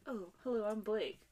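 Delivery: half-whispered, soft suave greeting; teasing, hushed impression of a dreamboat; mock supermodel. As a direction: imitate a smooth heartthrob introducing himself under the breath. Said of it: "he's like some supermodel".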